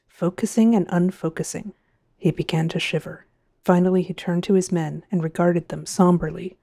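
plain reading voice

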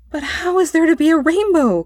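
high, puzzled outcry; boy's cry. A narrator's reading. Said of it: "cried the boy".

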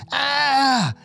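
high drawn-out "Ah" scream; mock scream. A narrator's reading. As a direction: act out a scream without extra volume. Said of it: "he screams".

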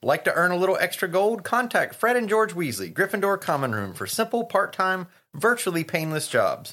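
playful advert voice; mock ad read. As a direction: read the sign like a pushy advert. Reads the twins' ad sign.

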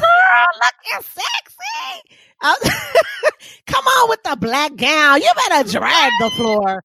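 high, slow shouted hype with laughter; party hype. Retold or imitated. Friends hyping each other's gowns, laughing shout. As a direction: cheer the ball gown. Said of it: "We was like".

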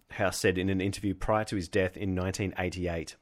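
usual speaking voice

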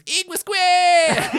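high, slow, drawn-out shout; laughing, hammed-up dragon yell; mock dragon shout. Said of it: "he goes".

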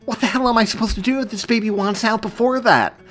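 high, loud frantic demand; furious, panicked outburst; enraged panic. A narrator's reading. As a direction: rage with rising panic. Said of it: "demanded and raged as a new wave of panic".

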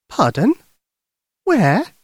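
put-on high, eager young girl; little girl voice. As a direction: speak like a worried little girl. Lucy asks.

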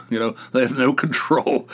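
usual speaking voice

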